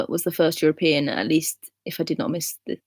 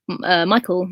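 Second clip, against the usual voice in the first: ordinary talk, nothing marked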